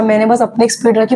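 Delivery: ordinary talk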